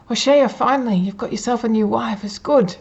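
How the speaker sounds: acted boisterous congratulation; hearty congratulations. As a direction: hail a friend with loud, pleased approval. Acted friend congratulating him in marketplace.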